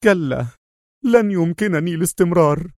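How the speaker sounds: high-pitched, despairing refusal; despairing cry. A narrator's reading. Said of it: "هتف في يأس".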